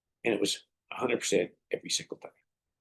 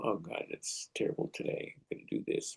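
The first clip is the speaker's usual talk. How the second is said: muttered under the breath, dejected; whispered gloomy thought; 8 dB quieter than usual. Retold or imitated. Listener's inner grumble about the day, whispered. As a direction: whisper the sour thought to yourself.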